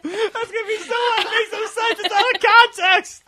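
high, loud, laughing protest; helpless laughter. Real, not acted. Host protests an out-of-context joke to co-hosts, real laughter. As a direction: squeal it out while cracking up. Co-hosts crack up over chainsaw remark.

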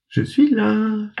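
put-on little girl announcing she is home; girl calling out. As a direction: call out to the parents through the door. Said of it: "cria Charlotte".

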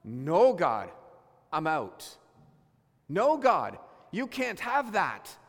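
loud, stubborn no to God; a firm, hammed-up refusal; defiant refusal. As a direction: push God away, slow on the last line. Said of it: "the way that this person responded".

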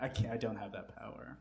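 ordinary delivery

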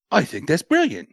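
put-on high-pitched, vague British accent; Nick Aldis. Husband approving the Rumble idea, put-on British accent. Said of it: "And Nick Aldis will go".

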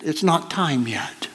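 a soft whispered line. Acted. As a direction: hush it gently, like a secret. Jesus gently stops Mary.

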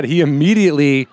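usual speaking voice

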